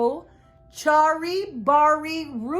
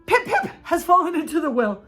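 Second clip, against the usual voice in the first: gasped call; 6 semitones higher, 5 dB louder than usual. A narrator's reading. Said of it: "he gasped".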